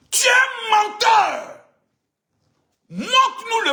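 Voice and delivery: high, slow, loud yell; yelling that he is a liar; shouted accusation. Real, not acted. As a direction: hurl it at him as a shout. Calling Johnny a liar.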